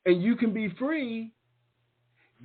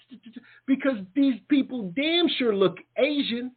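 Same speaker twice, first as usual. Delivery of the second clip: ordinary talk, nothing marked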